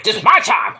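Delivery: mild mock indignation; mad. Wrestler objects to being interrupted by Elias, mildly mad. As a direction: imitate an irritated protest, lightly acted. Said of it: "he's mad about that".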